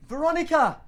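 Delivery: high calling out, name stretched long; mock call across the pool for a friend; woman calling her friend from ten metres back, high calling out. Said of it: "just yelled out".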